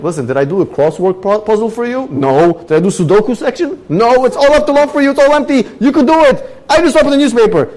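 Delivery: high, loud exasperated defense; defensive protest. Retold or imitated. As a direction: argue it's no harm. Neighbor defends himself.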